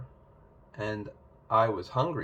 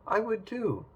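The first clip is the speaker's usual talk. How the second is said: eager; 10 semitones higher, 3 dB quieter than usual. Acted. Princess eager to read.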